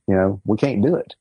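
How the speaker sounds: normal talking voice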